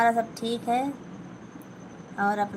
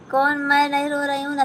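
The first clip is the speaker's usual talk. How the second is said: fast, high and louder protest; insistent denial; 5 semitones higher, 5 dB louder, faster than usual. Real, not acted. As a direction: rush to insist you're not the one crying. Insists she is not crying. (ज़ोर देकर तेज़ इनकार)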